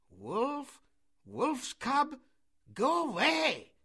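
high, loud cries of banishment; priest shouting. A narrator's reading. Priest driving out the wolf's cub, shouting. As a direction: shout it in bursts, rising in pitch. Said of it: "shouted the priest".

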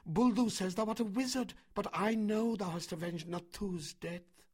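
high, urgent woman's voice; crying out. A narrator's reading. Mesva warning her son of the mob, crying out. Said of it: "ran across to the herd and cried".

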